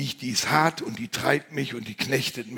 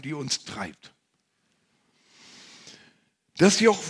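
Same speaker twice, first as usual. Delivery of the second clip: ordinary talk, nothing marked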